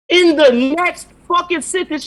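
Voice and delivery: exasperated loud. Real, not acted. Ranting in disbelief.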